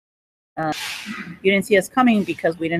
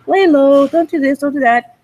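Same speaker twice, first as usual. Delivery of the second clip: high, louder mock warning; voice of common sense; 7 semitones higher, 6 dB louder than usual. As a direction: lay down the rules, pitched high. Voicing common sense giving warnings.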